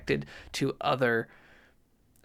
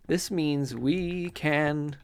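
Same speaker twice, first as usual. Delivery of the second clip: ordinary delivery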